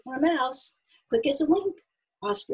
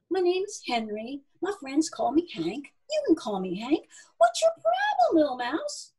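higher-pitched, folksy introduction; friendly animal Hank; 6 semitones higher than usual. Acted. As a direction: introduce yourself as a chummy barn animal, rising to a curious question. Narrator voices friendly animal Henry.

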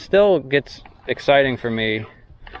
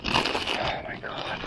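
breathless, whispered and slow; exhausted whisper; 9 dB quieter, much slower than usual. Real, not acted. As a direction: sigh it out spent. Exhausted after the long paddle.

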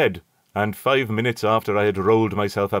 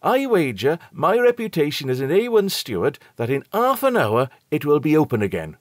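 higher, louder cocky servant voice; triumphant steward; 7 semitones higher, 4 dB louder than usual. A narrator's reading. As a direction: steward boasting to the passenger. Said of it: "continued Robert triumphantly".

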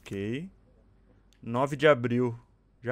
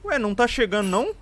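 confused; 7 semitones higher, 5 dB louder than usual. Real, not acted. Puzzled while playing.

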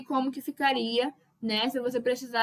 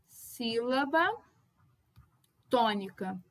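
ordinary delivery

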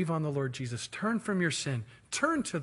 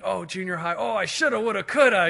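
mock agonizing, rising in pitch; hammed-up regret; regretful; 4 semitones higher, 5 dB louder than usual. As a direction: groan over old mistakes, building louder. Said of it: "those moments of regret".

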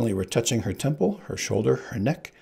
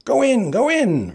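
much higher, slow, louder; frantic order; 14 semitones higher, 8 dB louder than usual. Acted. Sicilian ordering the others into the water, high shout. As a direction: yell it high and urgent.

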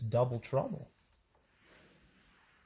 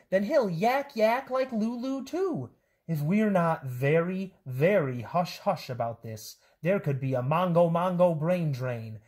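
high, lively storybook voices with swooping pitch; bouncy picture-book read-aloud; animated children's story reading; 5 semitones higher than usual. A narrator's reading. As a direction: read it to kids, playing up every silly word.

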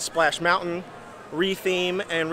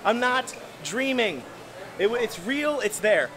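excited; 8 semitones higher than usual. Retold or imitated. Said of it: "and I'm like".

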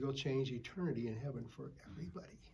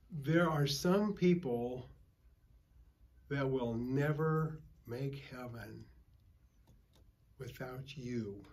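slow emphasis; slower than usual. Real, not acted.

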